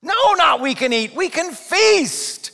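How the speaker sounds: high, loud, FEAST stretched long; emphatic correction. Shown as a demonstration. Preacher correcting Eve's weak answer, exuberant emphasis. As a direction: proclaim "feast" with soaring gusto. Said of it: "That's what she should have said".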